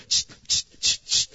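quick repeated hisses; imitated spray bursts. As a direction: fire off short spray hisses.

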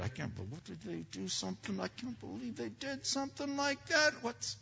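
high, incredulous inner voice; mock outrage, pitched far up; exasperated disbelief. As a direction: think it in shocked dismay. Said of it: "the thoughts were in your head".

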